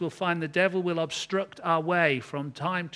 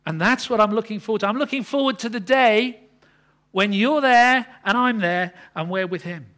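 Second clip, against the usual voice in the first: louder, higher, fast preaching build; impassioned preaching; 6 semitones higher, 11 dB louder than usual. Acted. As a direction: swell with longing toward the day.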